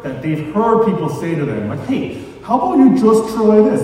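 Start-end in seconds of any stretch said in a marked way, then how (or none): 1.9-3.8 high, loud know-it-all tone; pushy unasked-for tip, sung out high; mock backseat advice. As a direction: needle them like a smug backseat driver. Mocking backseat drivers' advice.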